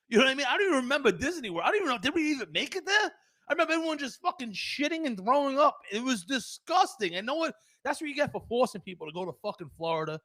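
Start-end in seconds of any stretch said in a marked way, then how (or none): 0.1-8.1 high, loud, fast comic rant; worked-up rant. Real, not acted. Telling the crowd about the family food poisoning, loud rant. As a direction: get fired up telling it.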